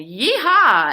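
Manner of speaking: Grandma galloping down Pall Mall; yee-haw shout. A narrator's reading. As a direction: whoop like a rodeo rider. Cheer while driving coach.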